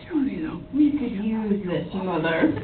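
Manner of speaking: scheming character. Acted. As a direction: muse slowly, as a schemer in character. Tamaki eyes the unicorns, speaks.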